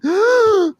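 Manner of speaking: high theatrical gasp. Shown as a demonstration. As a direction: gasp like a startled courtier. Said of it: "I was a court gasper queen".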